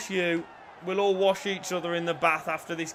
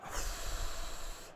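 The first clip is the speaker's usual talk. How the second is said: long, soft exhale; long sigh; 10 dB quieter than usual. Real, not acted. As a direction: let out a slow breath after the scare. Tense moment in match.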